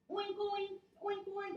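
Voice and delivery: high, squealy little oinks; pig oinking. Shown as a demonstration. Teacher rolling in pig pose with kids, high oinking. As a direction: oink up high like a piglet. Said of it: "Let's make it".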